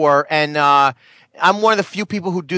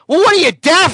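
mock Jerry; 7 semitones higher, 4 dB louder than usual. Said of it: "he says".

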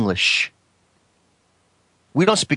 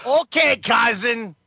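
high, hammed-up cousin voice; excitable cousin character; 6 semitones higher, slower than usual. Inviting his cousin to play darts, excitable character voice. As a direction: call out merrily in a silly put-on voice.

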